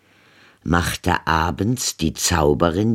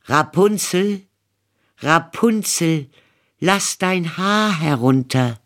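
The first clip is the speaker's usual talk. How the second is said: put-on a young prince calling to the tower; 13 semitones higher than usual. A narrator's reading. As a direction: call up to her window. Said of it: "ging er zu dem Turme und rief".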